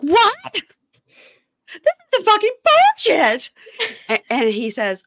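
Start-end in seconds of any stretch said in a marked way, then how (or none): 0.0-3.5 high, loud, outraged retelling of her rant; furious outburst. Retold or imitated. Wife exploding at husband over the dog, outraged shout. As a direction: blow up at him in disbelief. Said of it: "She's so mad".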